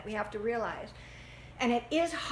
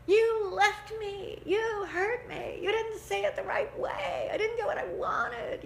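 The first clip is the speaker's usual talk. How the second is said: whining victim; 10 semitones higher than usual. Acted. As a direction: whine every grievance like a hurt child. Victim mentality acted out.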